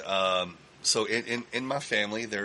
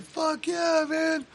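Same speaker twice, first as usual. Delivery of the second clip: excited shout; much slower than usual. Retold or imitated. Bandmates confirming they saw Scarface, excited shout. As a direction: cheer it out.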